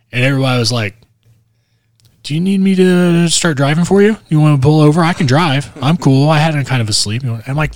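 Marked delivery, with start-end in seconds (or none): 2.2-7.2 mock eager offer. Passengers offering to drive.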